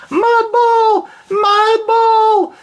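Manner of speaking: very high, loud fairy shriek of fun; joyful yell. Acted. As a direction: let it fly like a child joining the game. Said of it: "he heard Buttercup yell".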